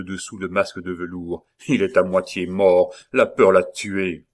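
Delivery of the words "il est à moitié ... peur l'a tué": loud, hollow scornful voice; sepulchral voice. Acted. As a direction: sneer from behind the mask. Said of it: "une voix sépulcrale". (voix sépulcrale méprisante)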